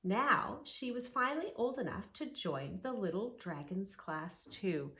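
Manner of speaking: ordinary delivery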